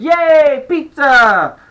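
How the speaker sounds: put-on high, loud kid's cheer; excited shout. A narrator's reading. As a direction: shout it in delight. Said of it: "The pain shouted".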